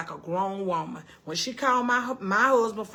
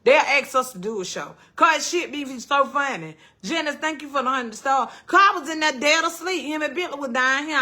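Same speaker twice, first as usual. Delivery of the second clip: ordinary talk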